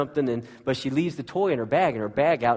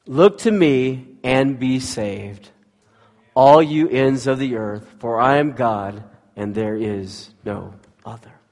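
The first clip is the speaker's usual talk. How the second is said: scripture reading; 7 dB louder, slower than usual. Acted. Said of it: "the Bible says this".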